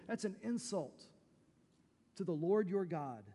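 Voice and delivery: normal talking voice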